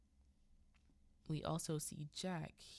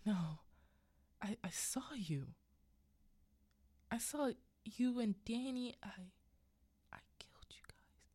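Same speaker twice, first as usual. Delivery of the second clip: halting retold confession, fading to a whisper; shaken confession; 6 semitones higher than usual. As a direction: act out a man haltingly admitting his nightmare. Shaken man confessing his nightmare.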